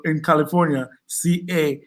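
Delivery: ordinary talk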